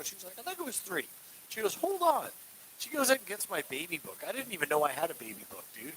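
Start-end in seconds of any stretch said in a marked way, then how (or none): 0.4-1.1 higher-pitched retold mom; mom's retold answer. Mom answering about the birth time, high-pitched imitation. 1.8-2.3 high-pitched imitation of mom; mom's retold interjection. Mom going to check the baby book, high-pitched imitation.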